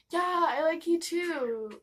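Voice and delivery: high, slow, offhand yes; bright casual reply. Retold or imitated. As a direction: say it lightly and brightly. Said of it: "he was all".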